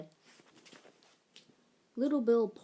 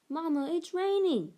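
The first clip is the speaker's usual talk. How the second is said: put-on disappointed child; 8 semitones higher than usual. A narrator's reading. Child complains to mother.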